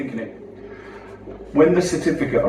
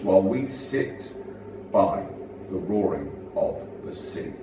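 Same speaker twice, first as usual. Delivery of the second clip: slow, ringing poem delivery; poem recital; 5 semitones higher than usual. Acted. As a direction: declaim it like a stage poet. Poem performance.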